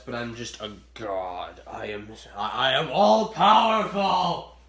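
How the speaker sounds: slow, building to a booming boast; mock almighty god. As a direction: grandly proclaim your godlike power.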